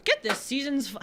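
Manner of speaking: mock pitchman; silly ad voice. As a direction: put on a goofy pitchman voice. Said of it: "I don't know why I'm reading it in this voice".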